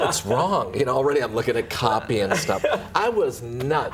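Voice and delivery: amused, laughing confession. Real, not acted. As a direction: chuckle at your own craziness. Laughing at his younger self.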